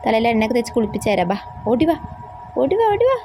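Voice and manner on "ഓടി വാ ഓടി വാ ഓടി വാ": calling out. Acted. Grandma calls child to come.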